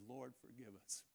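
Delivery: very soft, subdued plea to God; barely voiced repentant murmur; hushed prayer. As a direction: pray it under the breath.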